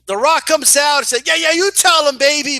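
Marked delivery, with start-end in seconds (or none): 1.3-2.6 high, loud mock cheering; imitated all-out fan. Cousin cheering The Rock on, going all out. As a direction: egg on your hero like a superfan. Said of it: "she was just going all out".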